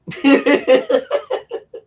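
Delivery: loud burst of laughter. Real, not acted. Laughs at dragon face idea.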